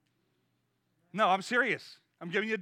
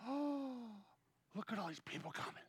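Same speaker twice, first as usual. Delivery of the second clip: hushed awe; 16 dB quieter than usual. Acted. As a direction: whisper it in wonder. Disciples watching crowds come.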